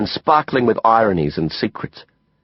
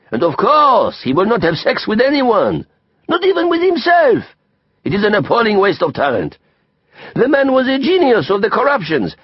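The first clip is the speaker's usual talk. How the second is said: put-on high, outraged French-accented tirade; clearly disgusted; 10 semitones higher than usual. A narrator's reading. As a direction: lament it with theatrical disgust. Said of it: "clearly disgusted".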